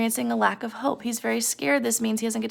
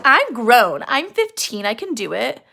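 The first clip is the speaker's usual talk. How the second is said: put-on defiant teen; 5 dB louder, slower than usual. Teen arguing with her mom.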